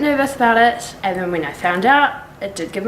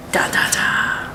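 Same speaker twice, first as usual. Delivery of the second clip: whispered; much slower than usual. Real, not acted. Secret project teasing.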